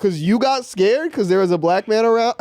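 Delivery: high, indignant questions; incredulous retort. Retold or imitated. Walker challenging the lady who got scared of him, incredulous. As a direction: throw the questions back in high-pitched disbelief.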